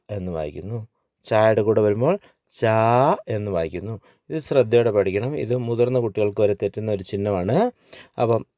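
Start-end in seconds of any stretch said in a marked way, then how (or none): 2.6-3.1 ചാ with a long, held aa; long vowel. Shown as a demonstration. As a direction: stretch the vowel in ചാ. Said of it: "ദീർഘം കണ്ടു കഴിഞ്ഞാൽ ആ". (ചാ എന്ന് ദീർഘമായി നീട്ടി)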